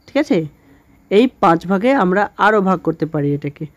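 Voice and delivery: normal talking voice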